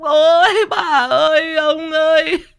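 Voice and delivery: high, loud, drawn-out fake funeral cry; mock copycat wailing. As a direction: wail along like a kid copying mourners. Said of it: "cũng ra cũng". (giả khóc gào theo người ta)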